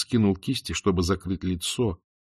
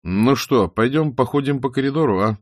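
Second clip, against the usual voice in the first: loud call; 5 semitones higher, 7 dB louder than usual. A narrator's reading. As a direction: call out the offer boldly. Opening invitation, loud. (громкое приглашение)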